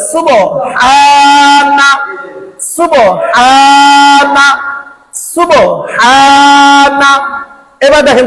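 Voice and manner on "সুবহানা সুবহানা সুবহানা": সুবহানা slowly drawn out, vowels held long; drawn-out Quranic recitation. Shown as a demonstration. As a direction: stretch সুবহানা like a teacher reciting. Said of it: "আবার বলেন".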